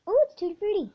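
high, loud excited ooh; excited squeal. Real, not acted. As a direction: squeal it out with delight. Tasting a jelly bean.

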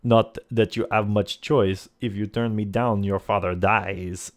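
put-on threat delivered in a light French accent; French-accented villain. Lafond threatens the player's father, French accent. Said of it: "he asks in a light French accent".

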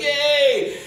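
high, loud crowd whoop; imitated cheer. Crew celebrating the stunt, mock cheering.

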